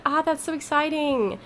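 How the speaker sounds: usual speaking voice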